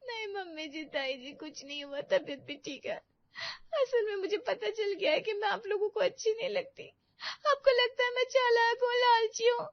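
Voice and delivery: soft, tearful sobbing words; crying. Acted. Preeti to mother-in-law, crying over what she overheard. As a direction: speak through tears, hurt. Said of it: "तू रो क्यों रही है?".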